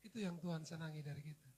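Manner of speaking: ordinary delivery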